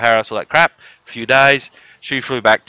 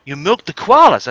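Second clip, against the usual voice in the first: higher, louder, disbelieving; a guest who can't believe it; mock astonishment; 9 semitones higher, 7 dB louder than usual.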